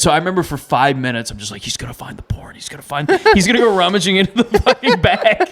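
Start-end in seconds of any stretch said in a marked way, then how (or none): 1.3-3.1 hushed frantic whisper to himself; frightened mutter under the breath; panicked whisper. Retold or imitated. Panicked inner monologue whisper. 3.3-5.5 laughing panic. Retold or imitated.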